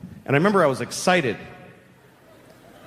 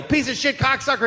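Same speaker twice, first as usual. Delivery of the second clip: imitated yell; 8 semitones higher than usual. Boy overhears some guy swearing, imitated high yell. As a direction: imitate a guy yelling an insult. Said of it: "I heard some guy yell".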